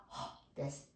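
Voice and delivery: "は" said on breath alone, unvoiced; just air for "は"; demonstrating h as breath only; breathy h. Shown as a demonstration. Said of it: "息だけの音が".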